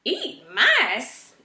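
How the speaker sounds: shocked outburst; exclaiming. A narrator's reading. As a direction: blurt it out in disbelief. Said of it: "Erith exclaimed".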